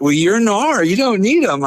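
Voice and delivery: high-pitched imitation of a hyped-up buddy; mock hype. Buddy waving off safety chains, mock hype. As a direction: push it up high and drawn tight. Retold buddy hyping him up.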